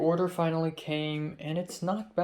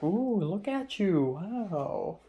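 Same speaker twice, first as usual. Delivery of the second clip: higher, slow impressed "ooh"; drawn-out mock-impressed wow; teasing praise; 5 semitones higher, much slower than usual. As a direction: tease her friend as if dazzled. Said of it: "she's like".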